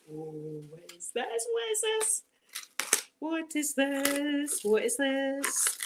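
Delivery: high, drawn-out amazement; hushed wonder. Real, not acted. Unwrapping a surprise gift, hushed high wonder. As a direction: coo slowly in disbelief at a surprise.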